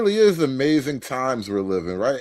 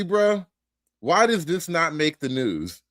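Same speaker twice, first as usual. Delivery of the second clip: ordinary talk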